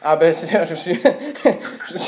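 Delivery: can't hold it in, burst out laughing; breaking into laughter. Real, not acted. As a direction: laugh breaks through the serious act. Prank caller cracks up mid-call.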